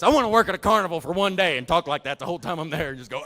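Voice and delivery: ordinary talk, nothing marked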